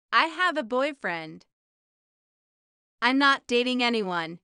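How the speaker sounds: usual speaking voice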